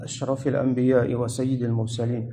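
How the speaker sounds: low, rapid Arabic recitation; formal opening invocation. Real, not acted. Preacher opening a religious lesson with praise and greeting, formal recitation. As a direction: recite the blessings quickly in a subdued, low voice.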